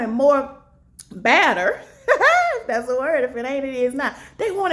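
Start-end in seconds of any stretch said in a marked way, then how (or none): 2.1-2.5 high, loud burst of laughter; real laugh bursting out at your own slip; amused laugh. Real, not acted. Speaker amused at their made-up word, high burst of laughter.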